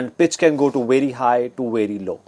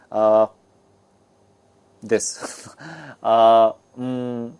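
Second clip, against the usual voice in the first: hesitant ums with long gaps; demonstrated halting hesitation; filler words; 3 semitones lower, much slower than usual. Shown as a demonstration. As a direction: stall with drawn-out fillers. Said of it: "have filler words".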